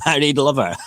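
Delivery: breaking into laughter mid-headline; giggling while reading; laughing. Real, not acted. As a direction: laugh through the last words.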